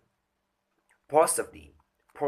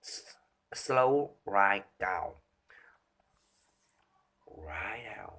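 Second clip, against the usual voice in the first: slow, whispered and fading; slow right down; 3 semitones lower, slower than usual. Shown as a demonstration. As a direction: drag it out into a hush. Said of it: "I can slow down".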